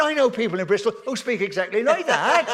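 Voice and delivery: real laughter breaking into the story; laughing through a quote. Real, not acted. Telling the audience his cousin's words, laughing.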